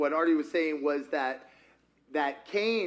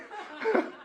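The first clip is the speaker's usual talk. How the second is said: high giggle. Real, not acted. Laughs at own wrong take.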